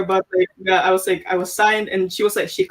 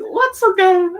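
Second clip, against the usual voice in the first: much higher, a little louder, disbelieving; baffled question; 12 semitones higher, 4 dB louder, slower than usual. Retold or imitated. To the mentor asking about a gamble, high baffled disbelief. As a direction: toss it back up high in disbelief. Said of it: "So I was like".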